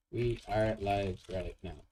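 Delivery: slow, word-by-word dictation; drawn-out, measured typing voice; dictating while typing. Real, not acted. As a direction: spell out the post aloud, one word at a time.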